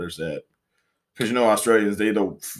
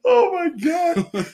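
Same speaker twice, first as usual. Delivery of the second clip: slow, very high-pitched squeal; scandalized amusement; much slower than usual. Real, not acted. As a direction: draw it out in helpless, high disbelief. Appalled amusement at the koala joke.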